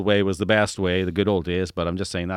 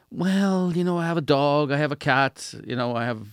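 higher, lightly imitating someone making excuses; mock excuse voice; 6 semitones higher than usual. Interviewer imitates candidates who won't travel, higher mock excuses. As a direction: lift the pitch and list the excuses. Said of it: "there are other people too who go".

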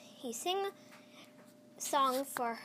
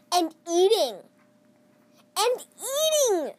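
much louder, higher, building on each eating; emphatic repetition; 7 semitones higher, 11 dB louder than usual. A narrator's reading. Narrator stressing the elephant eating all the food.

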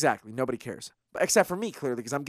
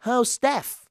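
bad mock Irish accent, pitched higher; a clumsy stab at an Irish lilt, teasing; hammed-up Irish accent mocking Becky; terrible Irish accent; 7 semitones higher than usual. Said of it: "That's a terrible Irish accent".

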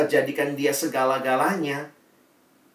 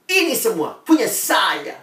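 mock possessive boast; 5 semitones higher, 6 dB louder than usual. Manusia mengklaim semuanya.

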